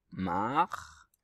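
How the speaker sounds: drawn-out syllable while writing; dictée syllabique. Shown as a demonstration. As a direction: stretch MAR slowly, as if tracing it. Épelle le mot en l'écrivant. (dictée lente, syllabe étirée)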